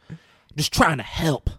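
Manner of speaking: protest, then give up in a huff; mock exasperation. Retelling a helper storming off, mock exasperation.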